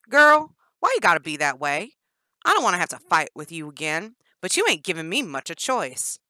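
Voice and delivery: loud twangy threat; Danny's twang. Acted. Danny threatening Jennifer in the cage, loud twang. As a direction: menace her with a drawn twang.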